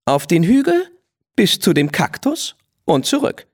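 put-on a proud young mare daring a rival; spirited mare. Rose challenging Blackie to a race, high and bold.

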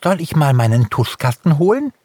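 eager question. A narrator's reading. Hare offers to fetch a paintbox, asking eagerly. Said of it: "fragte Langbein sofort".

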